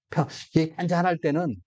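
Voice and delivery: usual speaking voice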